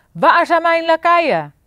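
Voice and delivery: high, loud royal bellow; king shouting. A narrator's reading. Furious king yelling for his lackeys. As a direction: roar it for the servants at full force. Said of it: "schreeuwde nog harder dan hij normaal al deed".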